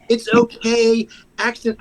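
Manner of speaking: sweet forgiving voice to the dog owner, pitched high; overly nice victim. Retold or imitated. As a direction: play the nicest victim ever. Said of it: "I was the nicest victim of a pit bull attack".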